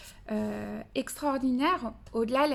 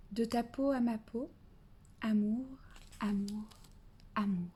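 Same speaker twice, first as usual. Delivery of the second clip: lecture douce de poème; 5 dB quieter than usual. Acted. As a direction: read it tenderly and unhurriedly, as to a baby. Lit un extrait du poème. (lecture de poème, douce et posée)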